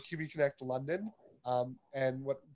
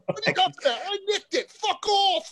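shrieky high, loud mock British; hammed-up British old lady voice; put-on British accent; 10 dB louder than usual. Prankster taunting the phone's owner, put-on British accent. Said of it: "I tried to do my best British accent".

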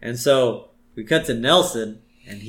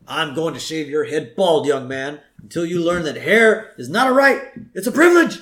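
stern principal building to a loud, high finish; acted principal's rant, rising in pitch and volume; mock scolding; 5 semitones higher, 5 dB louder than usual. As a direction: scold a pupil, climbing into an acted shout. Skinner scolding Bart, acted.

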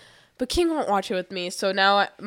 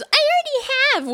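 mocking whiny excuse. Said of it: "his excuse is".

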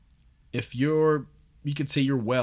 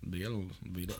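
put-on horrible British accent; 5 semitones lower, 11 dB quieter than usual. A podcaster mocking the band's name in a bad British accent. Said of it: "That was a really fucking horrible accent".

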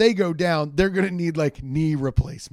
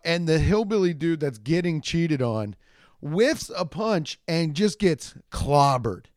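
ordinary talk

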